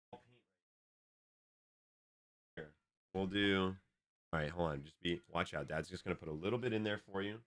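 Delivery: soft, careful murmur; quiet. Real, not acted. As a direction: hush while pouring. Quiet careful pouring for daughter.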